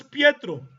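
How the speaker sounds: Pietro said as in Italian; Italian pronunciation. Shown as a demonstration. Lecturer listing Pedro in other languages, Italian pronunciation of Pietro. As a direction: give Pietro its Italian sound. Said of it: "que en italiano es".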